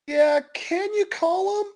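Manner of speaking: high, slow, timid plea; nervous request. Acted. Bobby asks mom to call police, high nervous voice.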